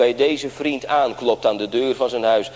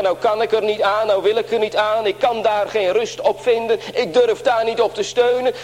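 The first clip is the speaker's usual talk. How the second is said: higher voice, a hint of an anxious churchgoer; lightly voiced doubting believer, higher; troubled doubter; 9 semitones higher than usual. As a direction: voice the hesitant listener, pitched up. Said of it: "tegen mensen die zeggen".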